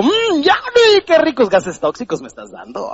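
mock-pleased body voice; sarcastic relish. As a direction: fake delight, dripping irony. Ironic body thanking for toxic gases.

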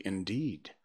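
ordinary delivery

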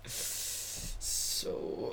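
imitated awkward hush; gone quiet. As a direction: voice drops to a drawn-out murmur. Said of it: "I get all quiet. I'm like".

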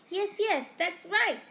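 tiny cheerful teacher beaming; squeaky professor. A narrator's reading. Flitwick beaming at Harry, high squeaky cheer.

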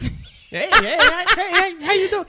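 very high, loud, chipper greeting. Shown as a demonstration.

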